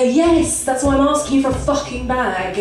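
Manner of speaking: mildly hammed-up irritated retort; exasperated customer. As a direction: snap back as a fed-up customer.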